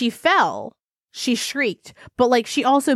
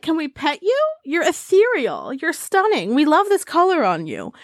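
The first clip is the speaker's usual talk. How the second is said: higher-pitched playful fawning; gushing adoration; 6 semitones higher than usual. Pretend. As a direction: fuss over an adored new pet. Fawning over a pretend pet creature.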